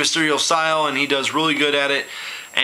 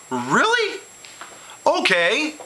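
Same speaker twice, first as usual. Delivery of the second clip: incredulous; 10 semitones higher, slower than usual. Real, not acted. Mock disbelief at booking.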